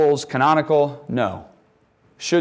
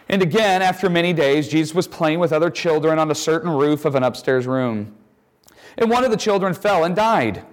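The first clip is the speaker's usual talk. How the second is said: reading the passage aloud, a little higher; scripture reading; 4 semitones higher than usual. Acted. Teacher reading a gospel story to students, lightly raised storytelling voice.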